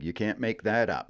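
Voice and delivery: ordinary talk, nothing marked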